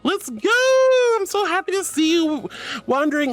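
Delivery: high, loud whoop easing into gushing; excited cheering. Real, not acted. Streamer cheering a viewer's first Pride, excited and high. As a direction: whoop it out, then gush.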